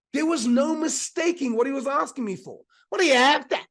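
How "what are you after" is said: loud, high Aussie bogan call; broad Australian accent. Retold or imitated. Diner mimics the waiter to companion, broad Australian accent. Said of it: "in this very broad Australian accent".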